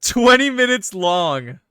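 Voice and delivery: slow, loud, drawn-out exclamation; mock outraged shout. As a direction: stretch every word like a mock protest. Mock outrage at game's claim.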